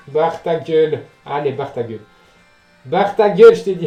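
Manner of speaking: loud, harsh shout of shut up; angry yell. Real, not acted. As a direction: bark it at the noise. Yelling at noisy neighbours.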